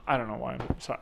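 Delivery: usual speaking voice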